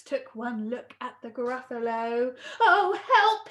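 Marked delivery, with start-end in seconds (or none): 2.6-3.5 high, loud cry for help; alarmed fox. Acted. Fox seeing the Gruffalo, alarmed. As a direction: yelp in acted fright.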